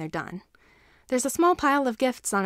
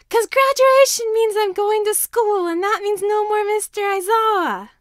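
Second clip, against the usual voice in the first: put-on wailing upset child; 12 semitones higher, 9 dB louder than usual. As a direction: cry out that you'll lose your teacher. Tearful child protests leaving teacher.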